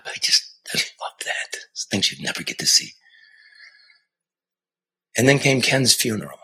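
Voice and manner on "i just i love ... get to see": breathy whispered aside. Real, not acted. A reporter whispering his love for the job. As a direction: whisper it to yourself.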